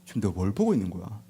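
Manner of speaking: low, soft stunned murmur; hushed disbelief. Retold or imitated. Disciple watching the sea suddenly go still, hushed disbelief. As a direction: barely voice your astonishment.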